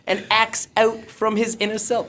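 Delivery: high, slow English accent impression; effeminate, drawn-out English accent in character; Russell Brand. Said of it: "You got to be Russell Brand".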